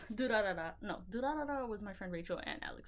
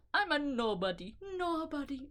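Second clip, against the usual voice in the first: quoting a movie line; 5 semitones higher, 5 dB louder than usual. Retold or imitated. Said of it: "If you know where that's from".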